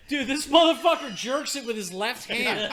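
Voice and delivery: loud, slow, laughing outburst; boss's loud quip. Retold or imitated. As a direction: boss blurting it out for all to hear. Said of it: "He was like".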